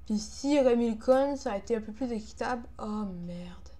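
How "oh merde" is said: breathy, voiceless "oh merde"; whispered curse. Real, not acted. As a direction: mutter it in a whisper. Muttered curse at game failure.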